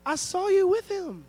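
high mocking little girl, half-hearted teen slang; sassy little girl. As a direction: call him a liar like a cheeky kid. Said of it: "she's like".

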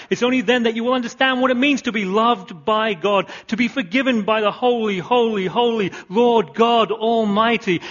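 loud, high, slowing on "holy, holy"; full-voiced sermon peak; preaching at full force. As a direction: rise to a ringing climax. Preaching climax.

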